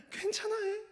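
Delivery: mock a mother frightened by the fall; panicked mother. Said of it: "더 놀라는 엄마들이 있습니다". (아이가 다치자 겁에 질린 엄마의 높은 목소리)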